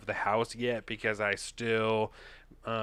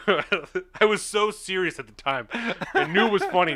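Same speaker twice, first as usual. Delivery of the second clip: laughing; 7 semitones higher, 6 dB louder than usual. Real, not acted. Laughing at own joke.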